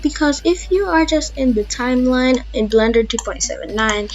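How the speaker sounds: ordinary talk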